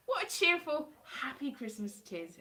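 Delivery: ordinary talk, nothing marked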